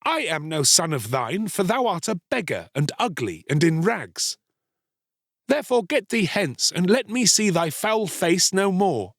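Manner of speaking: high-pitched, haughty anger; angry rejection. A narrator's reading. Boy sending the beggar away, angry and disdainful. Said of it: "cried the Star-Child angrily".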